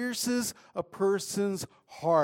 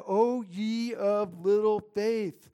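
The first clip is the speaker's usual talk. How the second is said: Jesus gently exhorting frightened disciples; gentle rebuke; 4 semitones higher, much slower than usual. Acted. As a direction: lovingly chide them, very slowly. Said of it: "he gently exhorted them".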